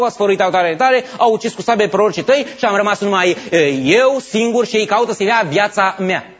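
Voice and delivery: a loud, weary complaint; frustrated. Acted. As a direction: vent the grievance loudly. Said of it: "spune frustrat".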